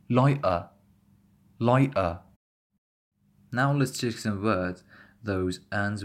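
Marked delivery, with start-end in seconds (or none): 0.1-2.2 "lighter" as ligh'uh with no sounded T; glottal T. Shown as a demonstration. Teacher repeating for listeners, glottal T in "lighter". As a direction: stop the middle of "lighter" in the throat. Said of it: "Just listen again".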